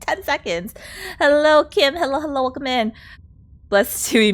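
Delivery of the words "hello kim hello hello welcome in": ordinary delivery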